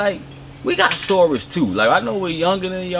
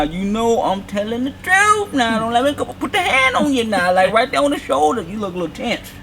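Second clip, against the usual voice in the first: high, silky charmer; smooth flirty voice; 8 semitones higher than usual. Pretend. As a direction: lay on the charm. Flirting with co-host.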